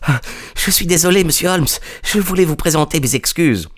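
high, breathless apology after running; out of breath. A narrator's reading. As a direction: apologise as the winded assistant, pitched high. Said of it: "nous rejoignit tout essoufflé". (tout essoufflé, excuses d'une voix aiguë)

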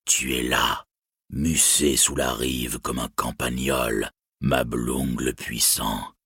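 low, slow and cold, whispered end; a dragon's chilling taunt, slowly; icy deep voice. Acted. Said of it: "une voix glaciale et profonde".

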